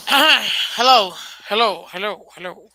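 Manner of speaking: rough, scratchy voice from a dry throat; hoarse throat. Real, not acted. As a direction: talk through a scratchy throat. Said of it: "You sound like you got, uh, ice in your throat".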